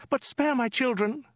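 high falsetto plea; hammed-up high-pitched begging; pleading frightened woman. A narrator's reading. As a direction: beg in a put-on woman's squeak. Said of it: "stammered the woman".

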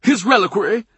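high, incredulous question; scandalised disbelief; upset, astonished. A narrator's reading. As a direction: ask it aghast, pitch shooting up. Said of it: "George is upset, astonished".

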